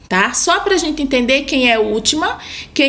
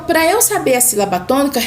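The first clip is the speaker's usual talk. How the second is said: normal talking voice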